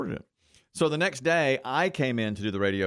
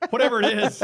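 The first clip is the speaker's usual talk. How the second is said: higher, louder repeat broken by a laugh; 11 semitones higher, 5 dB louder than usual. Real, not acted. Cracking up while repeating the joke to a co-host, laughing. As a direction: laugh the line out the second time.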